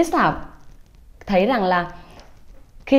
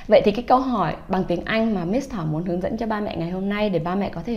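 ordinary delivery

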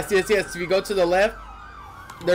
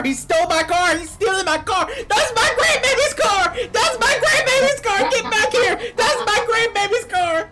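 put-on shrieking, sobbing old granny, much higher and louder; hysterical screaming; 4 dB louder than usual. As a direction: wail it out like a robbed grandma. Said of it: "I'm just screaming".

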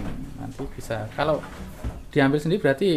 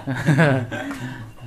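brief amused laugh; short laugh; 2 semitones lower than usual. Real, not acted. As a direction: let out a quick chuckle. Shared laugh at the joke. (tertawa singkat)

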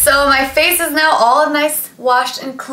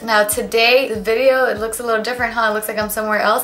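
normal talking voice

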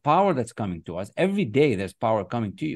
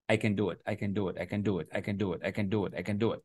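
much softer, muttered I can do it over and over; quiet repeated mantra; 3 semitones lower, 8 dB quieter, faster than usual. Shown as a demonstration. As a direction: mumble it to yourself like a rote chant. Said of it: "repeating an idea in the morning".

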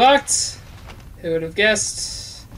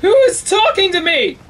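high, loud spooked question; freaked out by a voice; alarmed; 12 semitones higher, 7 dB louder than usual. Real, not acted. Hears voices in horror game.

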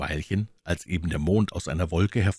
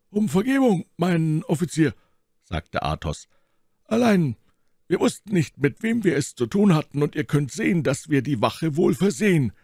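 lightly voiced Athos, courteous and higher; polite musketeer; 11 semitones higher, 3 dB louder than usual. A narrator's reading. Athos apologising to the unknown officer, lightly voiced. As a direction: excuse yourself with soldierly politeness.

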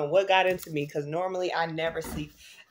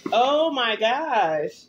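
delighted; 6 semitones higher than usual. Real, not acted.

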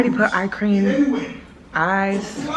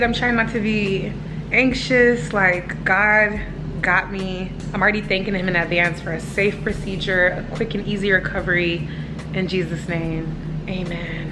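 usual speaking voice

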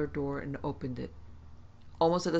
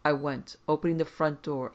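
plain reading voice

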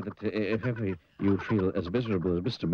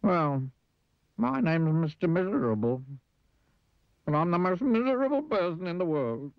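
high, woeful character voice; sad squeaky voice; 9 semitones higher than usual. Acted. Mister Miserable introduces himself, sad and squeaky. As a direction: whine it high and sorry for yourself. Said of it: "Sad, squeaky sort of voice".

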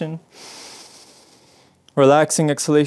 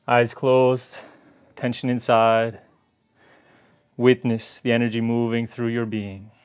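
guided meditation; 3 semitones lower than usual. Acted. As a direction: ease them inward with a hushed calm voice. Guided meditation after practice.

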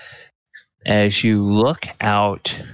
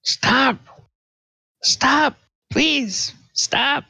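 put-on high, muffled child crying stop; slower than usual. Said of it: "you hear a muffled cry".

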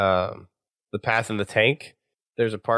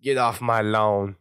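put-on country boy accent; slower than usual. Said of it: "in his best country boy accent".

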